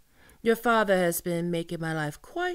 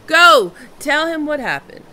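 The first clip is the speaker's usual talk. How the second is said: commanding pirate bellow; barked order; 8 semitones higher, 15 dB louder than usual. Acted. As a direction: shout it, much louder and higher. Captain orders a messenger off.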